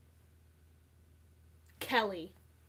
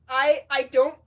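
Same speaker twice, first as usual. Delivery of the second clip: usual speaking voice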